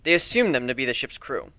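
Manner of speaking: ordinary delivery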